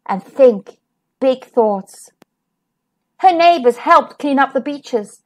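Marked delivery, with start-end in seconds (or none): none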